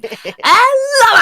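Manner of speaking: high, loud, very slow stream greeting; streamer voice. Pretend. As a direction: stretch the hello out like a streamer going live. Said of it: "I've got to do the streamer voice".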